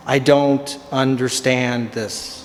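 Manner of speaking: baffled slow-motion repeat; slow exasperated repeat. Shown as a demonstration. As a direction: spell it out slowly in disbelief.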